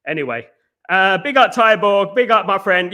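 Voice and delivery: ordinary talk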